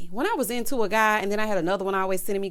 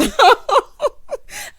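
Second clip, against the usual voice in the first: high, loud burst of laughing; real laughter; 9 dB louder than usual. Real, not acted. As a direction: crack up helplessly. Laughs at own annoyance.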